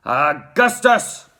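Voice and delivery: loud, drawn-out father's cry; slow, forceful man's call; Mister Gloop. A narrator's reading. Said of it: "cried Mister Gloop".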